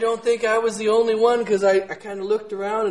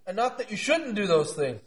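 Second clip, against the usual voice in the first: usual speaking voice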